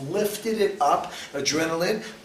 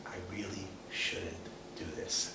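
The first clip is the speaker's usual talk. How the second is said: very soft, low, slow musing; hushed, hesitant inner voice; thinking to oneself; 9 semitones lower, 18 dB quieter than usual. Acted. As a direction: murmur it as a private doubt. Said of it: "And you're thinking".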